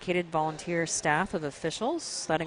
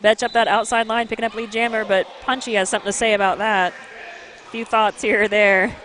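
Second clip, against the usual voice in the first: excited play-by-play; 5 semitones higher, 8 dB louder than usual. Real, not acted.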